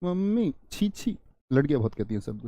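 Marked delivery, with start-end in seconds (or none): 0.0-1.2 ऊँचे, धीमे स्वर में "छी, छी"; गंदी चीज़ देखकर मुँह बिचकाते हुए; घृणा. Shown as a demonstration. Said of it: "जब घृणा पैदा हो". (घिन से बोलें)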